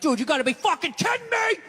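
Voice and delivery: high, indignant sports-fan yell; screaming at the ref. Acted. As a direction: yell in outrage at the TV referee. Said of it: "you're screaming at the ref on T V".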